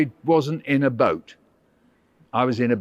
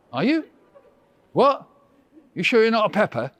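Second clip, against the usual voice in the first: surprised; 6 semitones higher, faster than usual. Acted. Acting his surprise at therapist.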